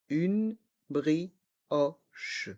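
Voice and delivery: slow "Une brioche", each syllable apart; learner-paced, split "Une brioche". Shown as a demonstration. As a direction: separate each sound of "Une brioche", down to the final che. Teacher breaks phrase into syllables.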